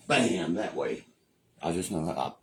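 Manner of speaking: ordinary talk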